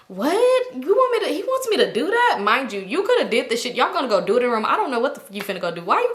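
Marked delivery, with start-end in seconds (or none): 0.1-2.4 high, incredulous imitation of her friend; mock disbelief. Girl retelling her friend's disbelief, high and incredulous. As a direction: act out the friend's shock, pitched up.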